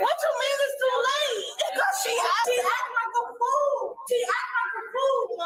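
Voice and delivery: crying out in disbelief; real tearful outburst; distraught protest. Real, not acted. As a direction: wail it, drawing the words out. Told too late, sister arrested.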